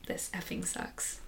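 slow, unvoiced whisper of frustration; whispered complaint. Retelling a frustrated outburst over the dishes, slow whisper. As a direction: breathe it out slowly like a muttered curse.

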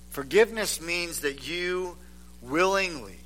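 quiet heartfelt sermon delivery; hushed preaching. Acted. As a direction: lean in and speak softly to the congregation. Soft, hushed sermon passage.

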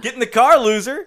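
loud, high triumphant yell; shouted movie quote. Retold or imitated. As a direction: belt it like a victory cry. Shouting the Mean Girls quote.

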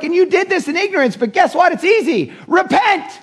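high-pitched, animated appeal; urgent exhortation. Retold or imitated. Preacher voicing apostles urging leaders to repent, urgent exhortation. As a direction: pitch up high, calling them to turn.

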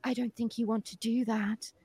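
soft, cool mock warning; imitating a snooty receptionist; bitchy receptionist. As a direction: say it quietly with catty disdain. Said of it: "this really bitchy receptionist comes in and goes".

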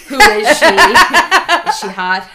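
loud, slow, laughing tease; mock jealous questioning. Teasing her friend about the sleep talk, laughing mock jealousy. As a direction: play jealous through laughter.

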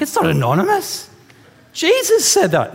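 rising to a high, loud protest, pitch climbing on the last words; indignant outburst. Retold or imitated. Preacher protests at the ad, rising high and loud. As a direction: correct the TV out loud with mock outrage. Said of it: "And I'm like".

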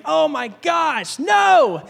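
slow, high, drawn-out cry of dismay; mock horror. Rider finding registration expired, exaggerated retold dismay.

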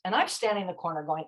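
usual speaking voice

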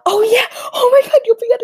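high, loud delighted outburst; excited shout. Real, not acted. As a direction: shout it as it comes back to you. Sudden memory, shouting.

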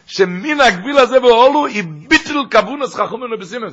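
fast, high, loud sermon drive; preaching. Acted. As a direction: press the teaching home with fire. Preaches the Purim law.

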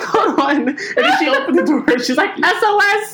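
high, startled mock girlfriend voice. Mary woken at four a.m., startled and confused. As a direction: squeak it like a shocked roommate woken at night. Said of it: "And Mary was like".

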